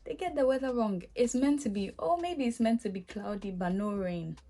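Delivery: ordinary talk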